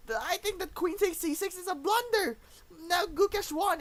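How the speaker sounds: high-pitched, emotional realization; astonished. Real, not acted. Commentator realizes Gukesh has won, astonished. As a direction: voice climbing as it sinks in. Explains the game-losing blunder, shocked.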